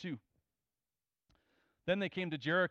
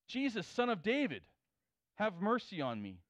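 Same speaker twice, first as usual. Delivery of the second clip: higher, louder calling out, drowning out the crowd; loud cry for mercy; 5 semitones higher than usual. Retold or imitated. Blind beggar calling out loudly to Jesus over the crowd. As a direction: cry it out over everyone around you. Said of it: "speaking so loud that it's drowning out everyone".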